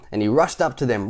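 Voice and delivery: usual speaking voice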